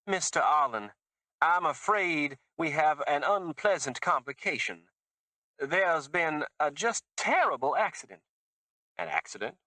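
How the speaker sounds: put-on high, gushing Southern accent; a fake Southern accent, high and gushing; fake Mississippi accent. A narrator's reading. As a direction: lay the fake Mississippi on thick. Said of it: "It was fake Mississippi".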